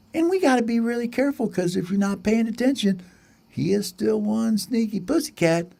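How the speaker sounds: high, hushed-urgent sparrow voice; warning sparrow. Acted. Sparrow warns about cat.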